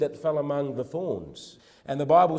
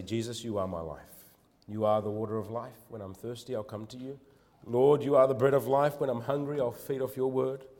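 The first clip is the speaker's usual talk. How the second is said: softer and lower, tender devotion; quiet prayer; 4 semitones lower, 4 dB quieter than usual. Acted. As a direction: pray it softly to the Lord. Prayer voiced for the congregation.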